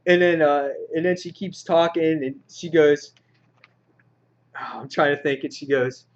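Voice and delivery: ordinary talk, nothing marked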